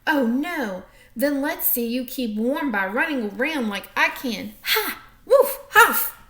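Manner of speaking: loud boisterous dog voice with a woof; dog bragging to the tortoise; taunting dog. Acted. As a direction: show off like a bouncy dog.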